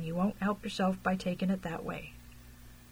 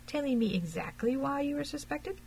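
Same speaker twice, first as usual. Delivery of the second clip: higher, coaxing question; insinuating; 6 semitones higher than usual. A narrator's reading. Detective probing why the man is suspected, insinuating. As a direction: insinuate your way into a delicate question. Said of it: "he said in a very insinuating voice".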